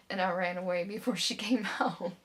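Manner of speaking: loud, laugh breaking into the words; gleeful laughing. Acted. Telling how she ran from her aunt, gleeful laughing. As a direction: tell it loudly, breaking into a laugh.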